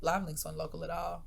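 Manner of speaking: ordinary talk, nothing marked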